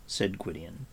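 ordinary delivery, nothing marked

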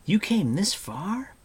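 high, slow, loud disbelief; astonished cry. A narrator's reading. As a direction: marvel aloud, incredulous. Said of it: "cried Taran".

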